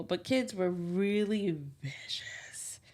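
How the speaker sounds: slow whisper; slow breathy confession; whispered aside. Real, not acted. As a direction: hush it out like a secret. Confiding about childhood bullies.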